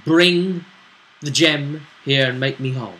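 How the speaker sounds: normal talking voice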